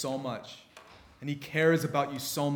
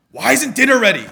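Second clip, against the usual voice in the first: retold angry demand, much louder and higher; angry dad; 8 semitones higher, 14 dB louder than usual. Dad coming home from work, demanding dinner angrily. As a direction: bark it like a hungry father.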